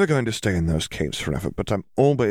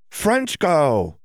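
calling out; 9 semitones higher than usual. Acted. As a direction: call out high to get attention. Ginny calling out.

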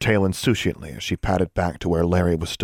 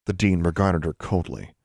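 plain reading voice

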